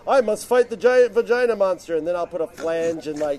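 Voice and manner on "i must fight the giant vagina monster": mock hero line. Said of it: "and it's like".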